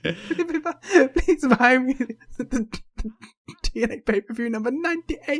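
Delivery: high-pitched, drawn-out begging, half laughing; pleading kid. Pretend. Teasing co-host as a kid begging Mum for the pay-per-view, pleading. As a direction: play a whiny little beggar, very high. Acting out pleading child waking up.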